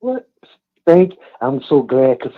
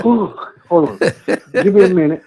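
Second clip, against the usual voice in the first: laughing and breathless; puffing, catching breath; 5 semitones higher, 4 dB louder than usual. Real, not acted. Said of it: "I done got out of breath".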